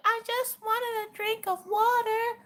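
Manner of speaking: put-on high soft little kid voice; high, soft, slow pleading child; cute pleading child. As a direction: play the sorry toddler begging off trouble. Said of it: "that commercial where the little kid".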